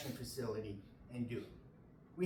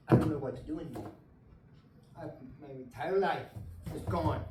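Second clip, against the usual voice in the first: fast, loud burst of real despair; blurting it out as the feeling breaks through; emotional outburst; 5 dB louder than usual. Real, not acted. Veteran telling the council his life is gone, louder in real despair. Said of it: "being so emotional".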